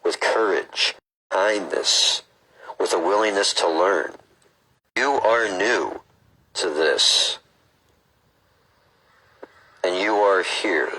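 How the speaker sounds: slow, measured read-aloud; book reading. Acted. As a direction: read it out deliberately, phrase by phrase.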